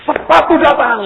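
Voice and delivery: high, very loud cry; excited shout. Retold or imitated. Preacher spotting the delivery man arrive, excited shout. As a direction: call it out at full voice. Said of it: "saya teriak".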